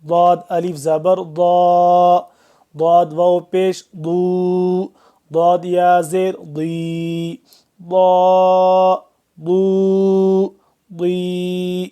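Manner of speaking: ضا thick, tongue side on upper molars; heavy ض. Shown as a demonstration. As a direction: model ضا ضو ضی thick and full. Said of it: "اس کو موٹا پڑھنا ہے".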